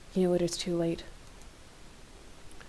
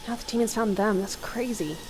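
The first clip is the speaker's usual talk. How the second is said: ordinary delivery, nothing marked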